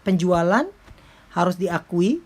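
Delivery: ordinary delivery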